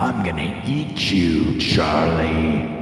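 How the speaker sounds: low, slow, menacing killer clown; creepy clown voice. Acted. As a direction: drag each word out like a predatory clown luring a child. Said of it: "that wasn't a voice changer".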